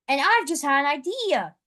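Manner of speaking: ordinary delivery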